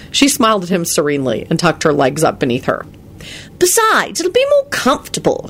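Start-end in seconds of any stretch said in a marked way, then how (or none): 3.6-5.5 airy. A narrator's reading. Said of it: "she added airily".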